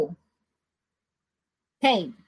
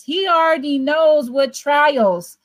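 normal talking voice

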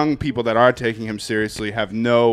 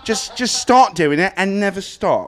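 higher, slow, put-on British accent; mock British accent; 5 semitones higher, slower than usual. Comic advising someone to commit to talking British, mock British accent. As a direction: exaggerate a British accent, drawing it out. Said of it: "if you're gonna talk British".

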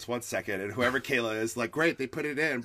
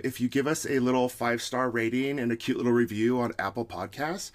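ordinary delivery

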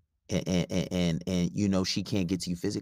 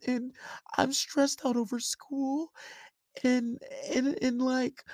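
high, whiny imitation of a tearful girl; mock tearful girlfriend. Guy imitating his girlfriend crying over school, high mock whine. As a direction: pitch way up and whine her excuses like she's welling up.

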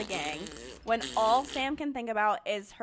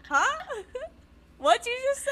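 much higher mock puzzlement; baffled Texan; 13 semitones higher, faster than usual. Texans puzzled by the newcomer's accent, mock bafflement. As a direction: imitate someone who did not understand. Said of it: "they're like".

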